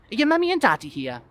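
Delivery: put-on high, loud curious kid; child's voice. Child grilling adult guest, high voice. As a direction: quiz him like a small child.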